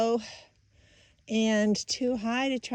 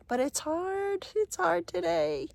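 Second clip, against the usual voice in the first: high, drawn-out whine of effort; plaintive complaint; 8 semitones higher, slower than usual. Real, not acted. Hiker admits to viewers the climb is tough, plaintive. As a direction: let the strain rise high and stretch it.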